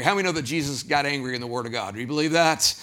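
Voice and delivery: usual speaking voice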